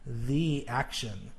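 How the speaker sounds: slow "the action", with "the" said thee; long E in "the", rhyming with see. Shown as a demonstration. Teacher working the exercise, "the" as thee. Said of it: "the rhymes with".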